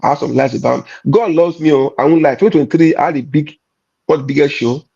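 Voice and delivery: usual speaking voice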